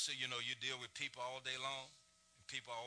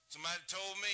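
ordinary delivery

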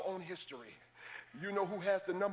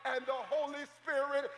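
preaching at full force; 6 semitones higher than usual. Acted. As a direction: preach it out to the congregation.

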